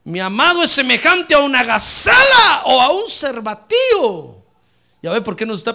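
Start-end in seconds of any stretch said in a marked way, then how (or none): 0.0-4.4 loud, higher proclamation of scripture; preaching shout. Real, not acted. Preacher declaring the beloved's coming, impassioned shout. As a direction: cry the verse out to the congregation.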